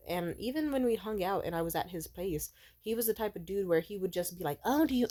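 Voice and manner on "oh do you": passive aggressive. Retold or imitated. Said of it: "like, passive aggressive".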